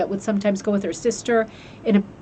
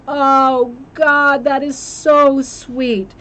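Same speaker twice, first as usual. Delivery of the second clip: higher, slow, melting "so sweet"; touched; 5 semitones higher, 5 dB louder, much slower than usual. Real, not acted. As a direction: let the poem get to you, sighing it out. Moved after reading the poem.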